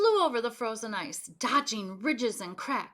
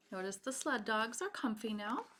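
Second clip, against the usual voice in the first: ordinary delivery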